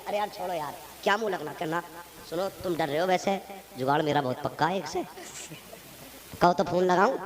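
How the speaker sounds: lightly voiced scheming broker; sly fixer. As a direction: wave it off like a crooked middleman.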